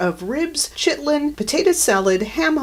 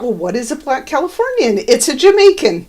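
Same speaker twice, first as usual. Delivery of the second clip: mock-naive, higher and louder; sarcastic; 6 semitones higher, 8 dB louder than usual. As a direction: sarcastically voice the writers. Mocking the writers' thinking.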